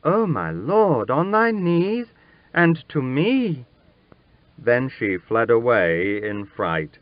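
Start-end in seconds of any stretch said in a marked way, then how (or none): 0.0-3.7 shocked outcry, climbing much higher; a lady aghast at a kneeling prince; horrified cry. Acted. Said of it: "She cried out".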